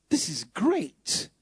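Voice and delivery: taste the words slowly as if in wonder; savouring it. Acted. Savouring the verse twice.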